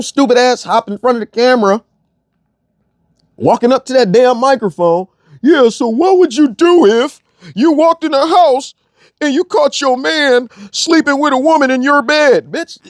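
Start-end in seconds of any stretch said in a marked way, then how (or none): 5.4-12.5 mock host voice. Mocking the panel host's question in a high put-on voice. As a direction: parody the host asking the panel.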